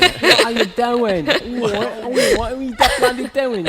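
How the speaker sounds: loud, high, hammed-up English accent; English accent impression. Shown as a demonstration. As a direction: do a jokey, over-the-top English accent. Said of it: "Did you hear my English accent impression?".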